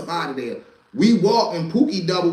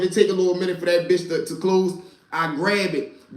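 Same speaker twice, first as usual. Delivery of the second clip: ordinary delivery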